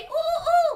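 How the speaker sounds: high, loud playful hoot; monkey hoot. Shown as a demonstration. Storyteller showing child the swinging monkey, high hoot. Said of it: "I need you to say".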